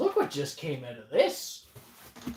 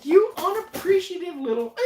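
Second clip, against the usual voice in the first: high, loud playful indignation; mock scolding; 14 semitones higher, 5 dB louder than usual. Host scolding ungrateful co-host over the boxes, mock outrage.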